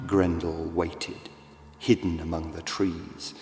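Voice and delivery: ordinary delivery, nothing marked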